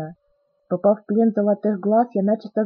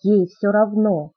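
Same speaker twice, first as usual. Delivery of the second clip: ordinary delivery, nothing marked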